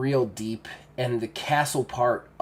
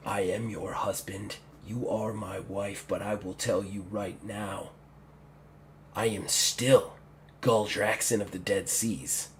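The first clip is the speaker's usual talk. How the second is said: low, soft, deliberate orc threat; quiet intimidation with pauses; controlled menace; 4 semitones lower, 7 dB quieter than usual. Acted. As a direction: assert your rank in a hushed voice. Orc's controlled threat to his wife.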